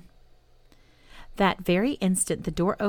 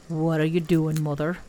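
plain reading voice

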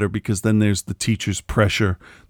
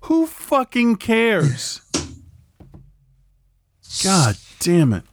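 much higher, very slow, drawn-out disbelief; exasperated rant; 7 semitones higher, much slower than usual. Real, not acted. Griping to a friend about car commercials, drawn-out and exasperated. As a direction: drag it out, fed up with the ad.

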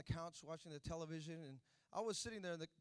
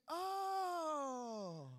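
put-on childlike oh; 10 semitones higher, 4 dB louder than usual. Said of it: "he's like".

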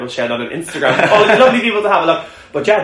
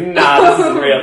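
laughing disbelief; 7 dB louder, slower than usual. Retold or imitated. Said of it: "I was in stitches laughing".

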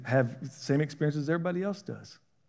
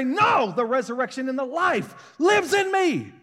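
slow, shouted, high proclamation; defiant declaration; 9 semitones higher, 4 dB louder, slower than usual. Pretend. Believer refusing circumstances, shouting defiantly. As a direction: shout the faith back at doubt.

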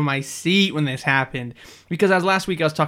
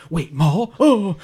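mock sudden realization; 4 semitones higher, 5 dB louder, much slower than usual. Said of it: "I'm like".

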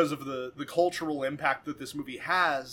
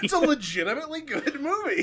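laughing; 11 semitones higher than usual. Real, not acted. Praising the movie, laughing.

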